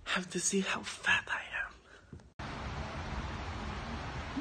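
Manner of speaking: hushed whisper, breath only. Real, not acted. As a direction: whisper it like a guilty secret. Weighing herself, embarrassed aside.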